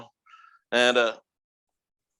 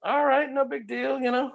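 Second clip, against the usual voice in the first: high mock surprise; 12 semitones higher than usual. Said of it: "And I'm like".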